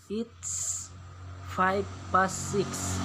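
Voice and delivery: "five past six" drawn out slowly; slow model reading. Shown as a demonstration. Teacher reads the British example slowly to pupils. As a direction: read it slowly like a model for the class. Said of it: "Ustadz bacakan".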